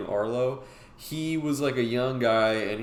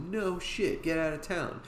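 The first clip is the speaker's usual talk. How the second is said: higher-pitched, impressed disbelief; retold amazement; 7 semitones higher, 3 dB quieter than usual. Bartender hearing they are full-time artists, amazed.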